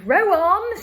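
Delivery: loud, high excited shout; shouting Row on. Acted. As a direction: bellow the order to the rowers. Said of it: "shouted Mister Wonka".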